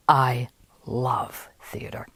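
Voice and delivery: slow, soft, whispered rapture; smitten, breathed reply. Retold or imitated. Woman answering the playwright about theater, slow whispered rapture. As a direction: breathe each word slowly, imitating a smitten listener.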